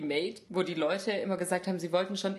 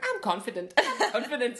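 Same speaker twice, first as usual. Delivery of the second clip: mock self-assured catchphrase, rising louder; imitated overconfidence; 5 semitones higher, 6 dB louder than usual. Quoting the overconfident contestant, mock self-assurance. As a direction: chirp it with laughing bravado. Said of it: "sie hat immer gesagt".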